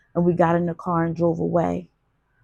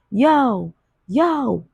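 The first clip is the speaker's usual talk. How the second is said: high, louder call of yo; yelling; 6 semitones higher, 4 dB louder than usual. A narrator's reading. Woman yells after the dealer, high calling yo. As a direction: shout to stop him walking off. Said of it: "I yelled to him".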